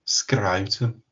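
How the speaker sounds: echoed Brummie accent with a rising end; Birmingham accent. Shown as a demonstration. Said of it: "funniest word to say in a Birmingham accent".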